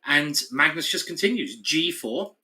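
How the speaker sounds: ordinary delivery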